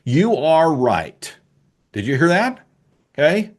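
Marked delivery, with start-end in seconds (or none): 0.0-1.4 you are right, each word slow and weighted; emphatic concession. Shown as a demonstration. Conceding to haters, slow and emphatic. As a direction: lay it down slowly, word by word. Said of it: "emphatically say to you haters".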